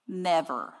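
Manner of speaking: usual speaking voice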